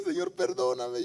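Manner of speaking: high-pitched mock crying plea; imitated tearful plea. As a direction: act out a penitent begging forgiveness, voice lifted high. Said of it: "viene aquí al altar llorando y le dice".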